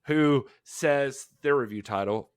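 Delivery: ordinary talk, nothing marked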